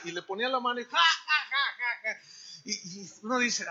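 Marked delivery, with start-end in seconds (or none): 0.8-2.2 put-on "ha ha" laugh, fading out; imitated mocking laugh. Healer lays hand on the sick, imitated mocking laugh. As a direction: laugh at the illness, hammed-up. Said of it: "oraba por los enfermos riéndose".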